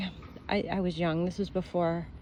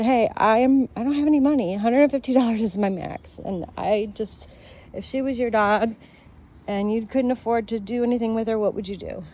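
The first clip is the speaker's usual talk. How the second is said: louder, higher desperate plea; pleading with the vet; 3 semitones higher, 7 dB louder than usual. Retold or imitated. Owner begging the vet over money, raised desperate plea. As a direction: beg the vet, voice raised and strained. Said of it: "I said".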